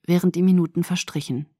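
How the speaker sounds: usual speaking voice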